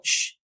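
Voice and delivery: sh like silencing someone; hushing sh. Shown as a demonstration. Teacher modelling sh like hushing a person. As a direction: shush as if quieting someone. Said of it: "como si callaras a alguien".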